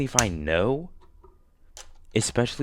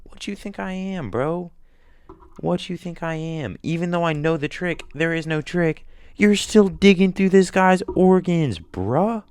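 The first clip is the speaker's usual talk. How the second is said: higher, agitated complaining; rant, pitched up and indignant; arguing with Jigsaw, higher and worked up; outraged rant; 10 semitones higher, 3 dB louder than usual. Pretend.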